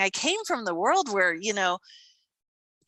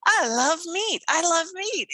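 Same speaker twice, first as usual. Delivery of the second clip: delighted; 9 semitones higher than usual. Real, not acted. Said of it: "repeated delighted declaration".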